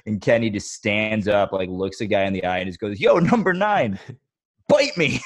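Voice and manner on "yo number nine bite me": high, loud taunt at an opponent; trash-talk shout. Retold or imitated. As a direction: imitate a cocky player calling out a rival. Acted trash talk from the film.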